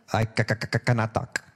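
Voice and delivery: mock stammering Moses; a hint of faked stutter as Moses; stuttering excuse. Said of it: "He uses an excuse of stuttering".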